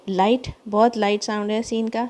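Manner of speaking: ordinary talk, nothing marked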